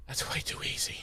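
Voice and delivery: breathy dying whisper; weak whisper. Acted. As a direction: barely breathe it out. Giles dying, weakly replies.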